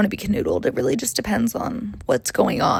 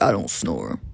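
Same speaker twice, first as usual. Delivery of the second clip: much lower, dumb-guy voice; mock boyfriend; 13 semitones lower than usual. Boyfriend denying he snores, in a low mock guy voice. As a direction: drop low and play the clueless boyfriend.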